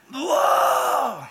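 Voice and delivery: loud high startled cry of disgust; shocked whoa. Pretend. Jesus recoils at the church's water, yelling whoa. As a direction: yelp as if spitting out foul water.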